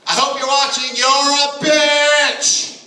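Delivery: drawn out, high and loud, "bitch" stretched; slow taunt to camera. Acted. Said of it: "say this right to my camera".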